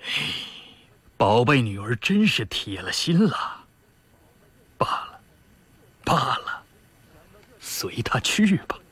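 exasperated father sighing and giving in; weary sigh trailing into near-whispered surrender; indignant inner thought. A narrator's reading. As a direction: think aloud, resigned and put out. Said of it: "心里愤愤地想".

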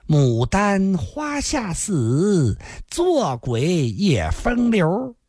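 very slow, savoring each word; slowly. Acted. Man muses on dying for love, very slow. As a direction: draw out the proverb slowly. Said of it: "缓缓地说道".

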